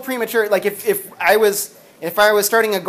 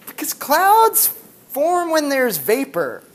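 patient dad; 6 semitones higher, slower than usual. Retold or imitated. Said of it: "And he says".

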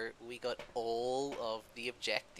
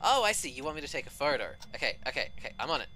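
customer service voice; 7 semitones higher, faster than usual. Acted. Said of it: "more annoyed by my customer service voice".

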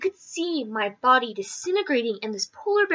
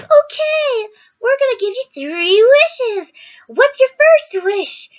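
much higher, louder and slow, like a magical granter of wishes; hammed-up storybook lady offering three wishes; high slow mock fairy-tale voice; high sing-song fairy lady; 11 semitones higher, 7 dB louder, slower than usual. Dream Eskimo lady offers wishes.

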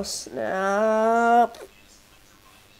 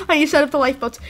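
ordinary delivery

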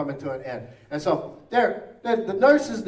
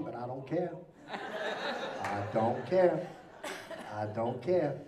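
soft, slow, laughing bliss; drugged bliss; 4 semitones lower, 8 dB quieter, much slower than usual. Retold or imitated. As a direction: drift off, blissed out.

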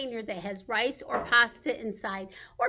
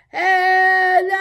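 big, loud, higher hello; 6 semitones higher, 12 dB louder than usual. Shown as a demonstration. Leading babies in saying hello as loudly as possible. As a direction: belt it out. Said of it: "as loudly as we can".

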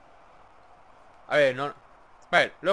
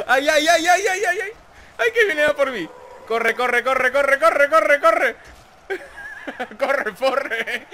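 panicked, building louder and higher; being chased; 6 semitones higher, 4 dB louder, slower than usual. Real, not acted. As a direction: scream to run faster.

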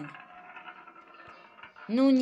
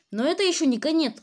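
excited; 6 semitones higher, 6 dB louder, faster than usual. Real, not acted. Бодро после смерти.

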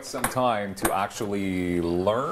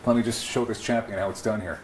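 ordinary delivery

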